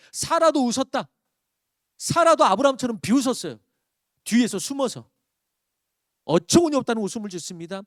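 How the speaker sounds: loud, high preaching, pressing the point; fiery sermon climax; preaching at full force. Acted. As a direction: drive it home from the pulpit. Preacher raising voice on Sarah's scorn.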